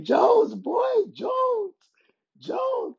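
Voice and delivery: imitated screaming, very high pitch; Jon Jones. Fighter imitating Jon Jones's greeting, screaming. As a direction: squeal it out like a wild hype man. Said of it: "He was screaming".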